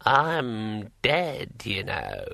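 put-on slow old dead man's voice, matter-of-fact; casual remark in an ancient voice; brittle old voice, conversational. A narrator's reading. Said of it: "in conversational tones".

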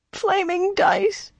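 high, slow whimper. A narrator's reading. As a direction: whimper it in pain. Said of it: "he whimpered".

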